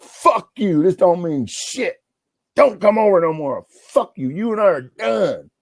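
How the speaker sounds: high-pitched, angry telling-off; angry outburst. Retold or imitated. Friend freed from handcuffs ends the friendship, angrily. Friend furious after being unhandcuffed.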